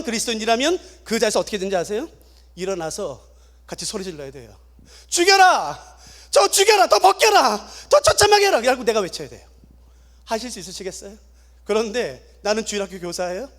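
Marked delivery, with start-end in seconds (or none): 5.1-8.6 high, loud chanted yells; forced crowd yell. Acted. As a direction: shout for blood along with the mob. Apostate forced to shout with crowd.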